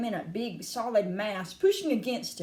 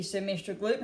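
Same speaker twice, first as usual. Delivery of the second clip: ordinary delivery, nothing marked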